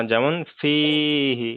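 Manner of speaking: যেমন ফিহি slow with ফিহি drawn out; slow recited fihi. Shown as a demonstration. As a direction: say ফিহি slowly, stretching it. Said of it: "না, না". (ধীরে টেনে ফিহি)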